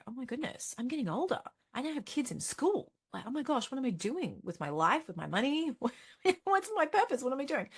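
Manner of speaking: flustered inner voice. Retold or imitated. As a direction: play the frazzled parent asking herself questions. Parent pondering life, acted inner monologue.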